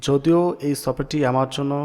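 ordinary talk

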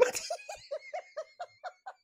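quick rising cackle; high laugh. Real, not acted. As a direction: burst of high, real laughter. Reactor laughs at the video.

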